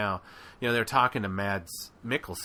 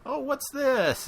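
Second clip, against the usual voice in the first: high, slow, wide-eyed mock discovery; a hammed-up gasp of discovery, pitched high; mock wonder; slower than usual. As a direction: squeak it out like finding treasure in junk. Rey finding something in garbage.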